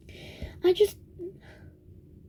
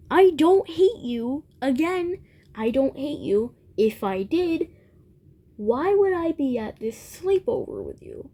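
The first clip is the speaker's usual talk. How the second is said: higher, emphatic mock retort; acted exasperated retort; 5 semitones higher than usual. Imagined retort to friend in quarrel.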